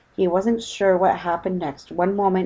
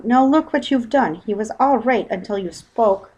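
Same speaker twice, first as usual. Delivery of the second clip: scolding; 5 semitones higher, 5 dB louder than usual. Acted. Wife scolds husband.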